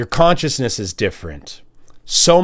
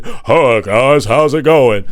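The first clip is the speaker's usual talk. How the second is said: slower, put-on Greg voice; Greg impression; 4 dB louder, slower than usual. The mimic greeting the room as Greg. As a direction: breeze in as Greg. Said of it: "sounds just like Greg".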